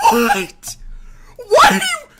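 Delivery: high, loud, laughing outburst; incredulous shouting. Real, not acted. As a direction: shriek your disbelief at him. Outraged at the misleading question.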